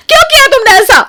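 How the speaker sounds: very high, loud tearful yell; anguished outburst. Acted. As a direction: cry out accusingly. Outburst over lost garden. (रोते हुए चीखकर)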